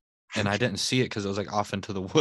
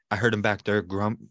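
normal talking voice

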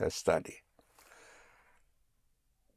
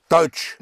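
"Deutsch" higher and louder; emphatic confirmation; 7 semitones higher, 6 dB louder than usual. Shown as a demonstration. Teacher confirms the learner's word, "Deutsch" loud and emphatic. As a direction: hit "Deutsch" hard as one syllable.

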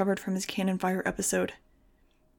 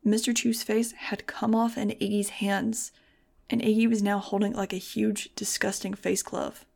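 ordinary delivery

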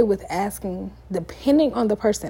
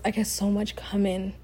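usual speaking voice

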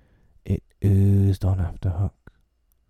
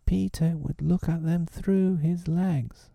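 high, bright inspired answer; eager cry; 11 semitones higher than usual. A narrator's reading. Boys remembering Peter's trick, crying out. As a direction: blurt out the idea.

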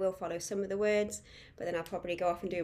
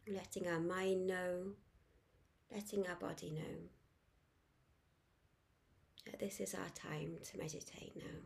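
guided meditation; 9 dB quieter than usual. Acted. Guide inviting listeners to focus on the breath, soft meditation voice. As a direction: ease them gently into calm, letting each phrase settle.

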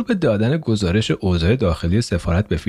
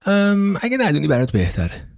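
evasive brush-off; 9 semitones higher than usual. Acted.

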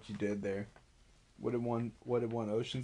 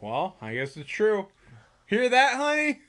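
playful dad teasing; 9 semitones higher, 7 dB louder than usual. Retold or imitated. Dad teasing wife in retold scene.

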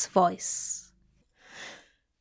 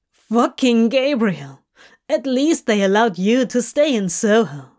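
Crowley spitting the name out; a demon cursing an archangel; Crowley, bitter; 4 dB louder than usual. Acted. Crowley voiced in podfic.

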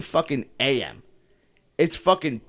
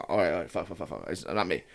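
low, trailing mutter; resigned self-talk; 10 semitones lower, 9 dB quieter than usual. Retold or imitated. Runner talking himself into stopping, low quiet mutter. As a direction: mumble it under your breath, pitched down.

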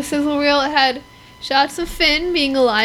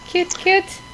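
cooing cute; 5 semitones higher than usual. Real, not acted. Cooing over porgs.

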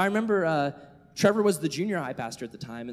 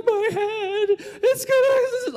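very high, loud mock wail; hammed-up shrieking child in tears; weeping kid; 7 dB louder, much slower than usual. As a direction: sob it out like a kid in meltdown. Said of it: "he starts weeping".

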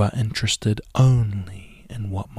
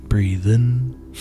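"Breathe in" called a little louder, lifting on "in"; breathe in cue; 2 semitones higher, 4 dB louder than usual. Shown as a demonstration. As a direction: cue the inhale clearly. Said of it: "When I say breathe in".

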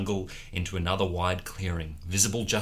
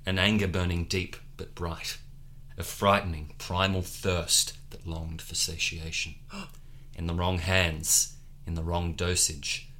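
ordinary delivery

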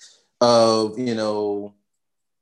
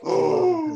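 heartbroken gasp; 14 semitones higher than usual. Retold or imitated. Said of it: "He was like".

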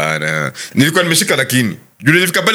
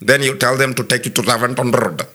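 imitated Indian accent; 3 semitones lower than usual. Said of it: "anatupa direction".

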